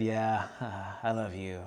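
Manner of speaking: imitating a tired, low, soft reply; quiet, worn out. Worn-out wife answering her husband after teaching, quiet. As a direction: answer low and softly, drained after a long day. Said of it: "just kinda quietly".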